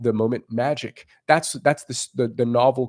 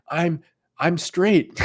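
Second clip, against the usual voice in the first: mock scared guy; 6 semitones higher, much slower than usual. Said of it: "They might be going".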